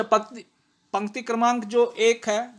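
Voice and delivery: usual speaking voice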